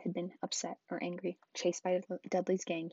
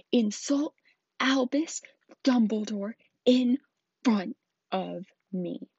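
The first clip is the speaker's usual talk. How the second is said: louder and higher, slowing to the end; thundering; 3 semitones higher, 10 dB louder, much slower than usual. Acted. Hagrid thundering at Uncle Vernon, loud and slow. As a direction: boom it out slow and menacing. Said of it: "he thundered".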